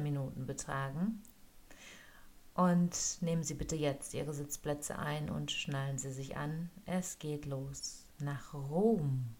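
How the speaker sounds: slow, calm cabin announcement; flight attendant. Acted. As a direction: welcome passengers aboard like cabin crew.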